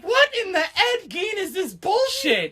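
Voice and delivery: high, laughing disbelief; laughing mock outrage. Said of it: "I'm like".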